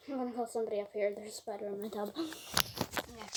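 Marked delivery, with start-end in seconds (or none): none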